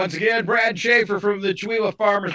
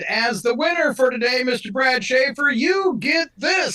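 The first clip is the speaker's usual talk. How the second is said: announcing shout; 8 semitones higher than usual. Real, not acted. Host announces winner's prize.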